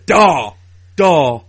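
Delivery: loud, isolated "Dar"; exaggerated "dar" syllable. Shown as a demonstration. Showing a co-host their odd syllable, loud "Dar". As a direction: punch out "Dar" by itself to mock the pronunciation. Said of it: "the way you say darling".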